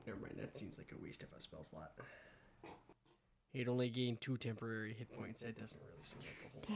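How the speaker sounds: unvoiced muttered aside; whispering. Real, not acted. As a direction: whisper it to yourself.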